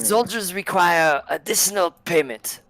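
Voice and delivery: put-on high, accented demand; a hint of thick German accent. Conductor demanding a bribe, lightly German-accented.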